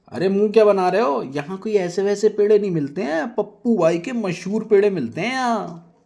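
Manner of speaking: high, loud nagging wife voice; shrill-pitched bossy wife; Nazia scolding. Acted. Nazia scolding, sharp raised voice.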